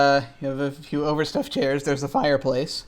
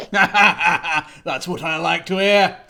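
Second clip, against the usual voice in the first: slow hearty laughing reply; 3 semitones higher, 6 dB louder, much slower than usual. Acted. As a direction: chuckle it out with glee. Vaughn laughing approval.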